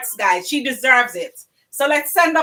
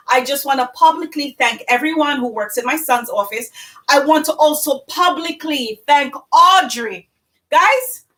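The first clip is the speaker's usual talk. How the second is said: usual speaking voice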